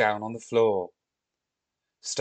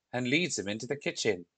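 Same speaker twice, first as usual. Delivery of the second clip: ordinary delivery, nothing marked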